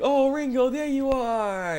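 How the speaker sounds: high, drawn-out mock delight; imagined adoring guy. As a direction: gush it like a dreamy fantasy greeting. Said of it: "she envisioned that".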